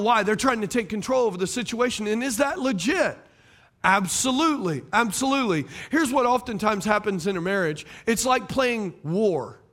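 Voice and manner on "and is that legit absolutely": ordinary delivery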